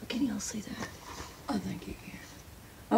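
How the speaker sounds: unvoiced hushed aside; secretive whispered check-in. Real, not acted. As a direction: drop to a breathy whisper for the viewers.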